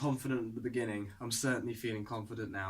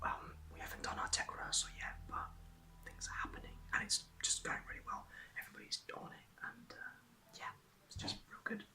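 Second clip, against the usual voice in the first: whispering so the rehearsal isn't disturbed; quiet backstage whisper update; 3 semitones higher, 8 dB quieter than usual. Real, not acted. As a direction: keep it to a hushed whisper backstage.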